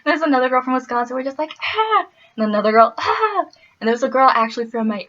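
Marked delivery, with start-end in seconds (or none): 1.6-2.1 high-pitched delighted squeal; excited squeal. Retold or imitated. Midwesterners greeting a fellow Wisconsin girl, high squeal. 3.0-3.4 high-pitched delighted squeal; excited squeal. Retold or imitated. Midwesterners greeting a fellow Wisconsin girl, high squeal.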